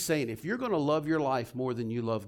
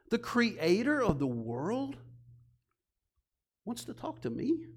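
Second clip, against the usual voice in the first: awed disbelief; 6 semitones higher than usual. Real, not acted. Preacher marveling to the congregation, awed and hushed. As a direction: marvel at it as if hearing it first. Marvels at God wanting him.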